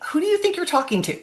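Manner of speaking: high, fast mock challenge; indignant parent. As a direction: act out an affronted parent picking a fight. Acted parent's fighting words, not to say.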